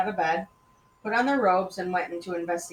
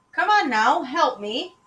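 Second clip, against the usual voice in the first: higher, louder character line; urging; 8 semitones higher, 6 dB louder than usual. Acted. Parent urging a partner to help, urgent and higher. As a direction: coax a helper along brightly.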